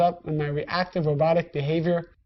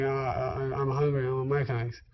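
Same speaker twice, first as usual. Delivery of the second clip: sheepish mumble; 6 semitones lower, 7 dB quieter than usual. Retold or imitated. Retold sheepish reply, mouth full.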